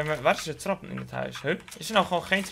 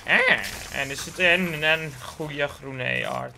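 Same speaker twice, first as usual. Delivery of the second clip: usual speaking voice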